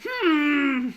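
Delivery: long hummed hmm, high and loud; mock thoughtful hmm. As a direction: hum like sizing up a snack. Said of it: "started going".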